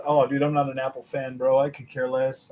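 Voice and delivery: ordinary delivery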